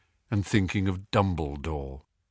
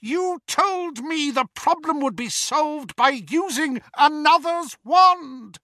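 screaming; 5 dB louder than usual. Acted. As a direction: shriek it with fury. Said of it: "a voice screamed inside his head".

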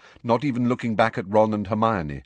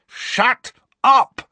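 furious shout; 8 dB louder than usual. A narrator's reading. Harry yells at Peeves.

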